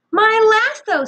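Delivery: high, loud joyful cry; boy overjoyed to get his rope back; delighted. A narrator's reading. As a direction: greet the lasso like an old friend. Finding his lost lasso.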